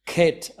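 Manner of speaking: క్యాట్ said as "kyaat"; letter name khet. Shown as a demonstration. Teacher shows how the letter is pronounced. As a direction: say క్యాట్ as "kyaat", not as it is spelt. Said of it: "పలికేటప్పుడు మాత్రం".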